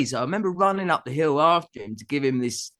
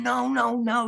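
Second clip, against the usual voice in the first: higher, mock-panicked no no no; retold panic; 11 semitones higher than usual. To Noel facing the drummer, mock panicked protest. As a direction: stop the standoff in a hurry. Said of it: "Everyone's freaking out".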